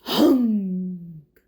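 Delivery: low, loud drawn-out honk; big truck honk. Acted. Reading aloud, the dump truck's deep honk. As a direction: blast it like a heavy horn.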